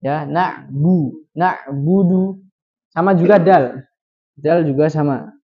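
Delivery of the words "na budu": Na budu drawn out slowly and clearly; slow Quranic recitation. Shown as a demonstration. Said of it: "Jadi biasa saja". (membaca na'budu perlahan seperti tilawah)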